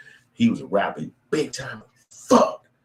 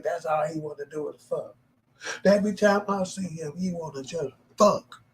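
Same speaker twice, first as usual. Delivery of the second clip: mocking girl voice; 11 semitones higher than usual. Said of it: "Them hoes be like".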